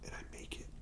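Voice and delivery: faint, spent whisper; mock dying whisper; exhausted whisper. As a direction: barely breathe it out.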